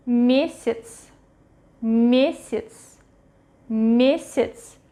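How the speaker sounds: месяц with я reduced to i, stress on the e; reduced middle Ya. Shown as a demonstration. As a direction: say месяц as MYE-sits, carefully modelled. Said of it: "pronounced as И".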